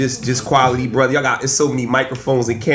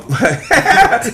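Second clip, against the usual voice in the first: a quick embarrassed laugh, higher; short real laugh; 8 semitones higher than usual. Real, not acted. Asked how old he is, a quick laugh. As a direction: laugh off the question about his age.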